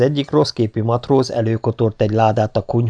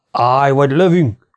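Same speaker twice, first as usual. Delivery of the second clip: slow, raised, drawn-out challenge; stentorian shout; 4 semitones higher, 4 dB louder than usual. A narrator's reading. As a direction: call out a warning across the dark, louder and higher. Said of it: "az ír fűtő stentori hangja". (öblös, elnyújtott kiáltás)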